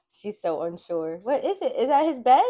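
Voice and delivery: normal talking voice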